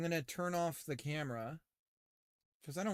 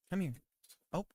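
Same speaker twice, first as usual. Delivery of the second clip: ordinary delivery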